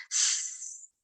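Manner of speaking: soft unvoiced hiss like leaking gas. Shown as a demonstration. Teacher showing gas-leak sound: quiet hiss. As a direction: breathe out a gentle hiss. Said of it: "gas leakage کی آواز".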